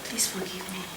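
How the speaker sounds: hushed, whispered plea; whispered apology. Acted. Partner begging forgiveness, whispered apology.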